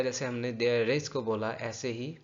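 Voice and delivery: ordinary delivery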